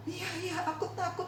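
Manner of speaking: high, small voice, a touch of fear; frightened little boy. Acted. Boy to doctor, admitting fear, small high voice.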